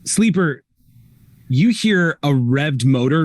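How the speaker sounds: ordinary talk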